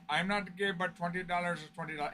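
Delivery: quiet, lightly put-on Indian accent; a hint of an Indian accent; mock Indian accent. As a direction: imitate the kids from India, softly. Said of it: "my best attempt at an accent".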